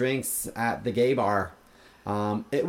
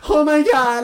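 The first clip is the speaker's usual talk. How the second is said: high, loud mock shriek; hammed-up scream of horror; horrified cry; 11 dB louder, slower than usual. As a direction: cry out like a drama queen. Acted horror at spiked drink.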